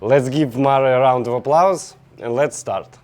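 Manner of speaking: ordinary talk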